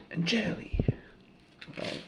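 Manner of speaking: whispered end of the song. Pretend. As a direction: drop to a whisper on the last word.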